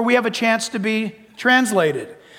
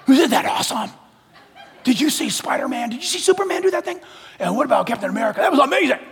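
excited; 4 semitones higher, faster than usual. Retold or imitated. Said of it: "we get all excited".

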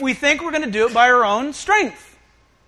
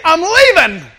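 loud, higher-pitched proclamation; blunt announcement; 5 semitones higher, 10 dB louder than usual. Acted. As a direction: drop the news on them at full volume. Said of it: "He's gonna say".

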